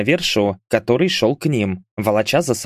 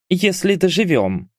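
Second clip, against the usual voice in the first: grumbled; 8 semitones higher than usual. A narrator's reading. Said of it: "буркнула Гара".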